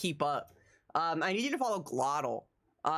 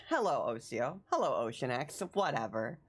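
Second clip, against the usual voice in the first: ordinary talk, nothing marked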